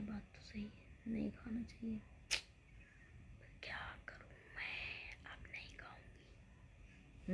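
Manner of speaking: hushed, sheepish whisper, slowing to a crawl; guilty whisper. Retold or imitated. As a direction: whisper it like a caught child promising to behave. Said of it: "मैंने".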